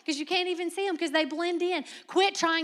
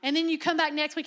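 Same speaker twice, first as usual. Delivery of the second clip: ordinary delivery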